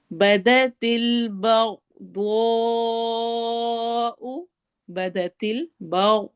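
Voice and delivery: slow recitation, الْبَغْضَاءُ with thick ghain; heavy ghain. Shown as a demonstration. Teacher demonstrating heavy letters for students, slowly. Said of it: "غین کو موٹا پڑھنا ہے".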